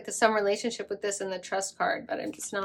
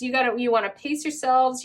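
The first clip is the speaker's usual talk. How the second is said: ordinary talk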